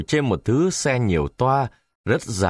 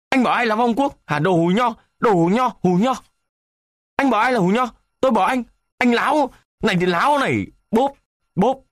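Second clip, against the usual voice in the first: much higher, louder enraged yelling; screaming; 13 semitones higher, 3 dB louder, faster than usual. A narrator's reading. Westernised man yelling insults before the fight, much higher and louder. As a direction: roar the insult back at him. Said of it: "gào lên".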